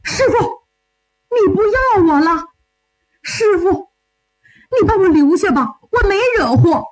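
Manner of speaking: high-pitched, distressed pleading; 徒弟苦苦哀求. Acted. Disciple begs not to be dismissed. (小徒弟慌忙高声哀求师傅)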